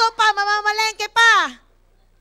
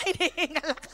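laughing; 7 semitones lower, 9 dB quieter, faster than usual. Real, not acted. Laughing retelling breathlessness.

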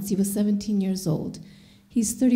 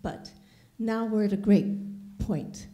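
usual speaking voice

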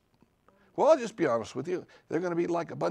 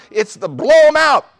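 high, loud preached proclamation; preacher roaring a rallying call; shouting preacher; 9 semitones higher, 11 dB louder than usual. Acted. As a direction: bellow it as a battle cry. Preacher shouts his slogan.

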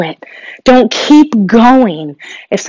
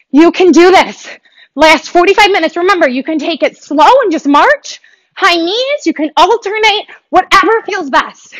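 higher, bright encouraging push; pumped-up coach; 6 semitones higher than usual. Real, not acted. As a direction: cheer the class on through the last seconds. Coach cheering through high knees.

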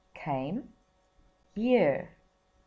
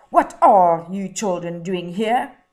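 much louder, demanding man; stern loud question; 13 dB louder than usual. Acted. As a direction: challenge the kids like an annoyed adult. Man confronts lost children.

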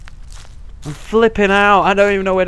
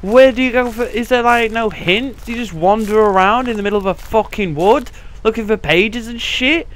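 ordinary talk

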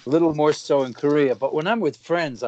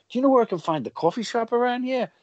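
put-on heavy Italian-American accent, pitched up high; 10 semitones higher than usual. Friend asking a girl for directions, mock Italian-American accent. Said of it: "you'd put on this heavier Italian accent".

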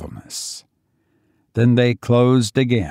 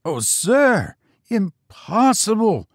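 high-pitched horrified servant; shocked old retainer's high exclamation; horrified cry; 10 semitones higher than usual. A narrator's reading. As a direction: cry out aghast, voice leaping up. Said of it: "cried Biggs in horror".

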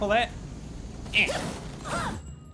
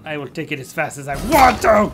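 defiant shout; 9 dB louder than usual. Pretend. Player talking back to the game's advice, defiant. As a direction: build to a loud defiant finish.

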